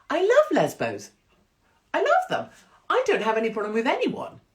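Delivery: high, loud mock gushing; shrieky over-insistent declaration; hammed-up protest. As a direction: protest too much, voice leaping high. Over-the-top protest of tolerance.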